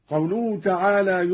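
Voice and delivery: usual speaking voice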